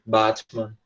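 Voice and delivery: Batman in a British accent, "batmɐn" with a broad a; British accent, Batman with an open a; British pronunciation. Shown as a demonstration. Teacher giving the British version of the word. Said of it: "britânico fala bat".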